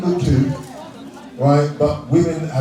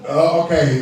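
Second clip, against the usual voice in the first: usual speaking voice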